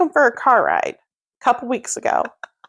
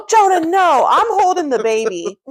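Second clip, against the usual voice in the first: high, mildly raised call; retold urgent protest; 6 semitones higher than usual. Parent holding the baby stops child, urgent high call. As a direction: stop the kid in a hurry.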